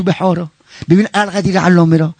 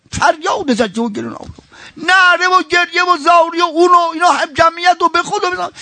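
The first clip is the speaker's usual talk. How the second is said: high, keening mourning sermon; high lamenting pulpit voice; impassioned rowzeh; 9 semitones higher than usual. Acted. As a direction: lift the grief of the story higher and higher.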